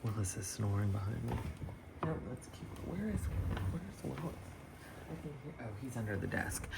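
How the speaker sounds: very soft, half-whispered; hushed aside. Real, not acted. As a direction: murmur it away from the listener. Aside about the sleeping dog.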